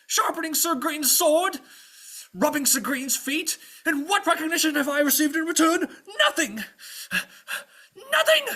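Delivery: high, loud wounded outrage; ranting. Acted. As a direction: build the grievances into a storming exit. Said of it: "he keeps on ranting".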